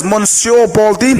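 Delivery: attempted French accent. Storyteller naming the tightrope walker, mock French accent. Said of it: "That's my best French, French accent".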